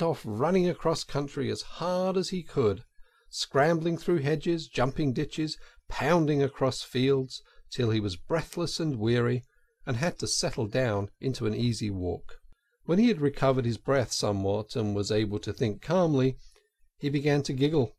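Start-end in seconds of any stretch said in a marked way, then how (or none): none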